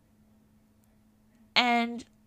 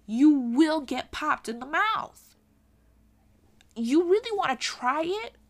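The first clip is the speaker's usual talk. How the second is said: loud, higher-pitched daring warning; challenging warning; 5 semitones higher, 6 dB louder than usual. Real, not acted. Warning people who use the friend excuse, challenging. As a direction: dare them, voice raised.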